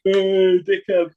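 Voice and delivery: loud high jeering call; mocking yell at the guest. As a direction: shout across the lobby. Receptionist mocking him, calling out.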